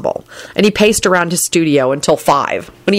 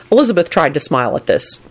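ordinary delivery, nothing marked

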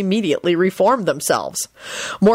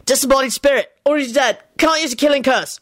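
higher, terse briefing; short, terse; 7 semitones higher than usual. A narrator's reading. Lupin briefs Neville mid-fight, tersely. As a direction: give the facts in curt bursts. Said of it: "Lupin told him shortly".

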